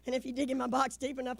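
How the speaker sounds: normal talking voice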